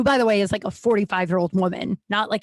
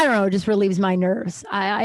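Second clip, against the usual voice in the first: normal talking voice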